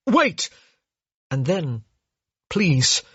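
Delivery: a hoarse, desperate call to stop her. Acted. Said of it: "his voice sounded impossibly hoarse".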